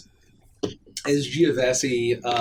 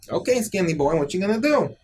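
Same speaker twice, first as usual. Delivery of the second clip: lightly voiced pushy little mobster, higher pitch; Pesto; 7 semitones higher than usual. Shorter brother challenges the musician, lightly voiced and higher. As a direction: challenge him in a slightly raised character voice. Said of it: "whose name is Pesto".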